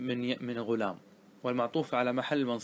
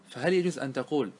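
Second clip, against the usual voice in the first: normal talking voice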